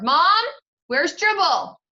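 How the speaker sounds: loud, high call for his mother; hollering. Acted. Peter calling his mother about the missing turtle, hollering. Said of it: "and hollered".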